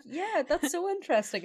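high, falsely attentive; fake interest. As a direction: pretend to be fascinated. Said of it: "You're like".

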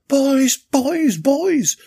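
high, loud repeated call to break up a fight; shouting to stop them. A narrator's reading. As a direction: yell boys to split them apart. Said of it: "shouted Natasha in reply".

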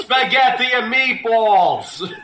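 high, slow mock Boston-Italian accent; hammed-up Italian Bostonian; Mark Wahlberg. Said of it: "what would Italian Mark Wahlberg sound like".

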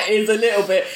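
laughing through the words, quick and high. Real, not acted. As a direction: giggle it out while agreeing. Laughing agreement about the film.

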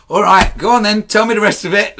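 loud, high, argumentative retort; exasperated challenge. Retold or imitated. Client arguing with a tarot reader by a Texas roadside, exasperated and loud. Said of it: "we just argued about it".

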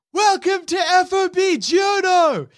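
high, drawn-out battlefield shout; yelling soldier. Acted. Soldier greeting newcomers over the helicopter noise, yelling. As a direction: yell it slowly over gunfire and rotors. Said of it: "he yells at you".

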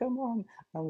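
put-on high, pleading little boy calling his mom; lightly voiced excited boy; eager kid. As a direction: sound like a child asking a favor. Kid bringing audition to mom.

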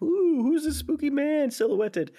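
high, slow mock-spooky wonder. Co-host mocking the show's mysterious reveal, mock-spooky. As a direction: draw it out like a spooky trailer.

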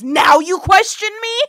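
loud, high, affronted retort; indignant snap. Retold or imitated. Khan rebuking Marla for questioning him, loud and affronted. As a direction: strike back at her doubt.

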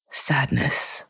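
whispered sadness. Acted. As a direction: breathe the word out in a whisper. Breathing out sadness, meditative.

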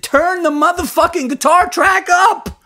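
very high, loud furious yell; furious producer shout; angry shout. Retold or imitated. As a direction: bark the order in a rage. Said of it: "Dave got mad and said".

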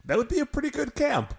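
high, wistful daydreaming tone; high wistful wonder; dreamy wistful. Real, not acted.

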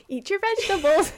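laughing, bossy mum. Pretend. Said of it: "we sound like a couple of mums".

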